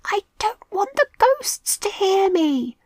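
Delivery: high, frightened whisper. A narrator's reading. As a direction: hush your voice from the ghosts. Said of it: "Milo whispered again".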